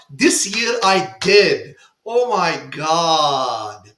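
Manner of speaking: loud, slow, awestruck exclamation; real wonder, drawn out and loud; excited. Real, not acted. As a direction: let the joy of finally being there burst out. Finally visiting the dreamed-of festival.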